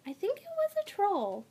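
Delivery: put-on high, awed girl. A narrator's reading. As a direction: say it half in wonder. Zarya confides in classmate.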